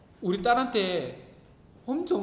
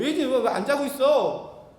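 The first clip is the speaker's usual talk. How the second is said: scolding; 4 semitones higher, 7 dB louder than usual. Retold or imitated. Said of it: "이러고 혼내니까".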